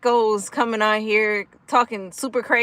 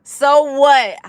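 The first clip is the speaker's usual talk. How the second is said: louder and higher defiant comeback; defiant louder retort; 4 semitones higher, 6 dB louder than usual. Real, not acted. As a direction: throw it back at the troll. Defiantly answering a chat troll.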